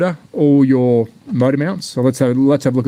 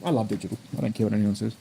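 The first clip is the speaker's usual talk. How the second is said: normal talking voice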